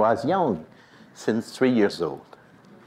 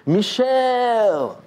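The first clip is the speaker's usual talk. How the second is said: calling voice; 10 semitones higher than usual. Retold or imitated. Voice calls his name again.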